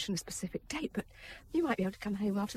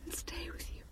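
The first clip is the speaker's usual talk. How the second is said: tearful whisper; 8 semitones higher, 11 dB quieter than usual. Acted. Wife confessing love, tearful.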